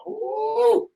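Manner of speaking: high, drawn-out ooh; excited "ooh". Real, not acted. Speaker reacting to thermostat question, excited high ooh. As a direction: let out a high ooh at your own point. Exclaims after his own question.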